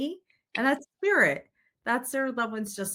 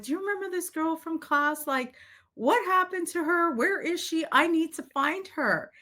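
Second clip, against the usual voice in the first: high-pitched, insistent retold plea; acted-out urgent appeal; urgent questioning; 9 semitones higher than usual. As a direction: press a friend urgently, pitched well up. Urgent asking friend for help.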